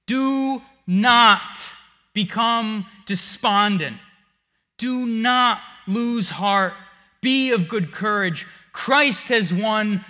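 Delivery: loud, slow, pulpit exhortation; preaching at full force. Acted. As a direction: hammer each command home, slowly.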